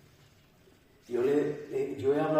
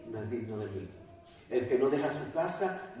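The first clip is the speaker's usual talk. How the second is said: ordinary talk, nothing marked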